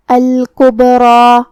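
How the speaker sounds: الکبریٰ recited slowly with a bouncing echo on the b; qalqala on the ba. Shown as a demonstration. Teacher demonstrates qalqala, الکبریٰ with a bounced b. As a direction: bounce the silent b in الکبریٰ, slow chant. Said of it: "حرف البا کو ہلاتے ہوئے یہ کلمہ کو ادا کریں گے".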